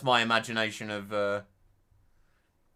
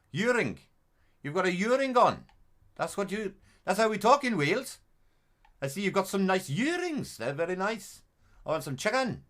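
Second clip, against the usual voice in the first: put-on high, loud sing-song Welsh accent; exaggerated high Welsh accent; 12 semitones higher, 6 dB louder than usual. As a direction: chat away in a broad Welsh accent. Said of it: "that's how I always do my Welsh accents".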